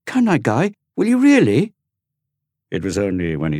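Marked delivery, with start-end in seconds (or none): 0.1-1.7 put-on very high, loud eager boy; light up with hope; excited exclaim. Dorian to his twin Guy, high loud eager exclaim. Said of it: "Dorian exclaimed".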